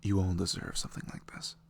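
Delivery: ordinary talk, nothing marked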